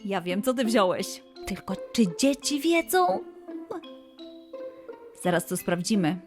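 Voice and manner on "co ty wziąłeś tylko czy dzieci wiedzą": ordinary delivery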